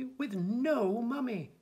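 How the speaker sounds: put-on high, slow baby plea; plaintive little cry. A narrator's reading. Baby telling the lady of no mummy, crying out mildly. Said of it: "cried the little baby".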